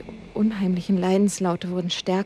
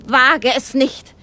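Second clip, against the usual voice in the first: higher, louder, slow warning; cried out; 5 semitones higher, 7 dB louder, slower than usual. Acted. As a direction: call out a furious warning. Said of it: "rief Alistair aus".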